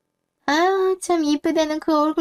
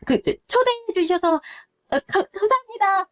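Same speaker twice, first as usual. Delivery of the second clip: high, halting, tongue-tied greeting; nervous stammer; 4 semitones higher, faster than usual. Acted. Girlfriend meeting the boyfriend's mother for the first time, stammering nervously. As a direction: stumble over the words. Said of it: "너 왜 이렇게 말을 더듬어?".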